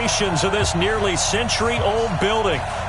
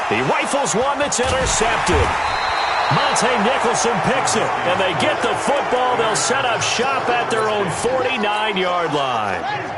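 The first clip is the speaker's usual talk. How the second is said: raised, higher interception call; excited play call; 4 semitones higher than usual. Acted. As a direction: call the pick with a jolt of excitement. Interception called live.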